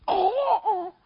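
high gasping oh with a laugh; surprised gasp. Retold or imitated. Eavesdropping disciple overhears the plan, gasping. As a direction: gasp as if catching gossip.

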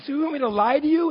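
high-pitched acted disbelief; incredulous question. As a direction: ask as if it's unthinkable. Acts out disbelief at wife's request.

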